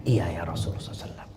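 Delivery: hushed, fast whisper. Acted. Preacher whispering Jabir's humble reply to the Prophet. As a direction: answer in a meek whisper.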